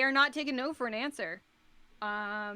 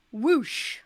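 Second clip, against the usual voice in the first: usual speaking voice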